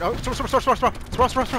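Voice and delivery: high, loud urgent yelling; excited shouting. Real, not acted. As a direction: yell it in a frantic rush. Urgent callout mid gunfight.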